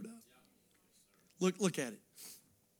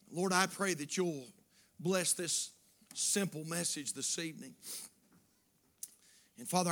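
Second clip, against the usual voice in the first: measured, earnest prayer with long pauses; closing prayer; 4 dB louder than usual. Real, not acted. Preacher asks God to help the church, earnest prayer. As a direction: pray it unhurriedly, phrase by phrase.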